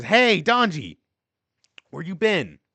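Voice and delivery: loud, high call across the yard. Acted. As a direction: shout out the window to him. Kid calling out the window.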